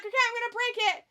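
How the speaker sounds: high, loud, fast announcement; playful rushed, high-voiced threat; eager mock threat. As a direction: blurt it as the wrestler about to do it. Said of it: "He's like".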